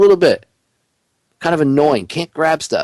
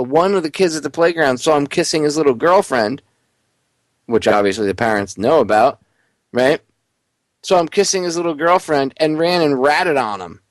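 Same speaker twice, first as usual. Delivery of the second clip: ordinary talk, nothing marked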